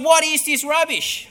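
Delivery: imitating a furious lecturer tearing into an essay. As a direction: bark it like an outraged marker. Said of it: "and he's furious".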